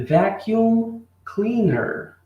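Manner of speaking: teacher spells out vacuum cleaner slowly for students; slow pronunciation model. Shown as a demonstration. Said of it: "ok na pronúncia".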